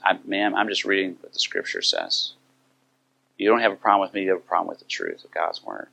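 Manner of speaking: soft, composed retold reply; calm. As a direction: answer her quietly, without confrontation. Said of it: "sharing with her calmly".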